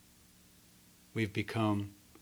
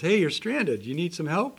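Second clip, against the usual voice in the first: called out, a bit higher and louder; calling out from a boat; 6 semitones higher, 5 dB louder than usual. Acted. Boatman calling out to the man on the roof, mild shout. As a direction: hail a stranded man from a boat. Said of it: "Guy shouts back, shouts and says".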